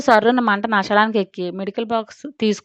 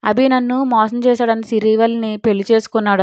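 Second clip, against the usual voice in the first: usual speaking voice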